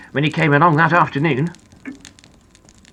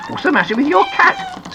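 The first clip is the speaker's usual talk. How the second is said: high, questioning character voice; puzzled small character; 13 semitones higher than usual. Acted. Little Ears asks his brother about the cat, high and puzzled. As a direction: ask in a high, concerned storybook voice.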